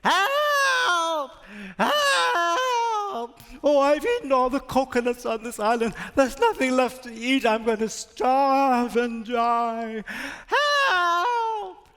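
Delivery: high, squealing cries of a starving little rat; rat screaming for help. Acted. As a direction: wail it high and panicked like a tiny rat. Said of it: "was heard screaming at the top of his lungs".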